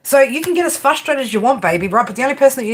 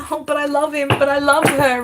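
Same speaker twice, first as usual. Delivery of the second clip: a lightly voiced, whiny lovesick client; mock lovesick excuse; 4 semitones higher than usual. Tarot reader mimics the smitten Aquarius, mildly mocking. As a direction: parody the client pleading their love.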